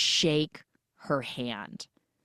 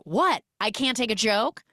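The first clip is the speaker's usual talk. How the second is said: raised, indignant retort in a retold quarrel; mock indignant; 6 semitones higher, 4 dB louder than usual. Husband chasing his wife out, acted indignation. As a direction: throw it back, acting affronted.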